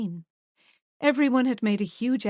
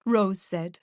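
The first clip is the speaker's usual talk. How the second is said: ordinary delivery, nothing marked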